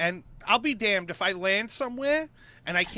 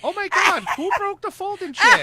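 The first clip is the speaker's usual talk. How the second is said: high, loud imitation of sister's shock; mock outraged yell; 9 semitones higher, 7 dB louder than usual. Sister demanding who broke her chair, mock outraged. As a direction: mimic her outcry, pitched way up.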